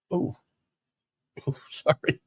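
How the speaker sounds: hiccuping while talking; words broken by a hiccup. Real, not acted. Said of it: "Hiccups again".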